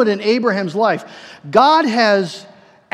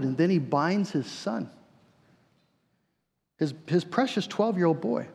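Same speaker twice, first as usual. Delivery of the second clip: ordinary delivery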